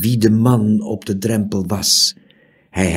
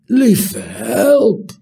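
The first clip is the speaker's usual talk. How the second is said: high, loud crying exclamation; horrified cry; 13 semitones higher, 5 dB louder than usual. A narrator's reading. Horrified cry. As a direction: innkeeper cries out hearing of murder. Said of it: "riep de waard".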